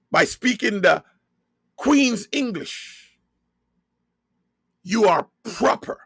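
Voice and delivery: normal talking voice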